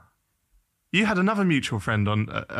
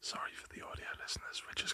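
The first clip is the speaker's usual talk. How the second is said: hushed whisper, barely there; whispered apology; 19 dB quieter than usual. Real, not acted. Host whispers an apology to the audio listeners. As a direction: whisper it as an aside.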